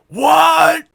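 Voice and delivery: high, loud cry of disbelief; shocked outburst. Acted. Suspect hearing their name accused, shocked shout. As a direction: blurt it out loudly.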